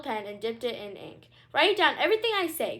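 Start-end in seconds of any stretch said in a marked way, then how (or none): 1.5-2.8 high, loud royal command; commanding queen. A narrator's reading. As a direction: order it like a haughty queen. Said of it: "commanded the queen".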